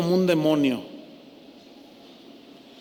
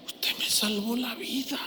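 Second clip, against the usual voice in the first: breathless whispered thanks, choked with tears; tearful whisper; 4 semitones higher, 9 dB quieter than usual. Retold or imitated. Old neighbour whispering gratitude to the man who saved her. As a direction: whisper it as if fighting back tears.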